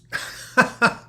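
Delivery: sudden high laugh of surprise; a real laugh at a funny phrase; laughing at a misread chat question; burst of laughter. Real, not acted. Laughs at "men meds.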